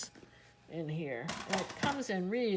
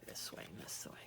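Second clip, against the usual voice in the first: whispered to self; 17 dB quieter, faster than usual. Real, not acted. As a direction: trail off into a whisper. Muttering while folding pages.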